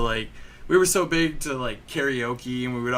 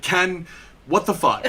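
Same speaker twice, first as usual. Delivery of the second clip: higher, drawn-out mock outrage; retold confrontation, raised pitch; retold angry dad; 5 semitones higher, 4 dB louder than usual. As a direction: play an exasperated dad through a laugh.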